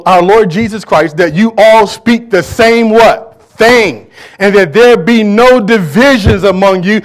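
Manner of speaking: loud, slow, drawn-out scripture reading; preaching at full force. Acted. Preacher booming Paul's words to the church, slow and loud. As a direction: thunder the verse out to the congregation, slowly.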